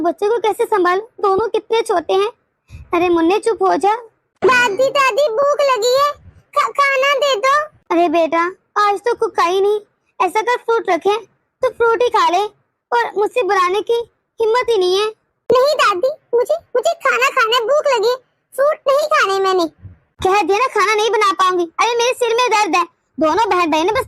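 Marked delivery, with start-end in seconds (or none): 4.4-7.6 put-on high, whiny little kid begging for food; small child whining to grandma for food. Grandchild voiced by same actor. 15.5-19.7 put-on high, whiny little kid begging for food; small child whining to grandma for food.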